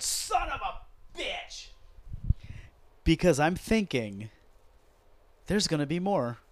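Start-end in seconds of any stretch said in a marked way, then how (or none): 0.0-1.7 high, slow drawn-out mock yell; retold yell. Throwing the remote at the TV, high drawn-out mock yell. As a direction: stretch the curse out high like a frustrated viewer. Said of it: "and I just yelled".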